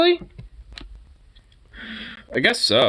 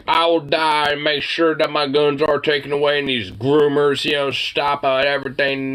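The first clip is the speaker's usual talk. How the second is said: higher, mock-earnest zealot voice; mocking conservative; 5 semitones higher than usual. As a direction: parody a gun-loving zealot's pledge. Mimicking a conservative's vow.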